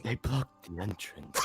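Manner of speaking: lightly voiced old man; a hint of an old man. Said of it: "I gave the old man voice".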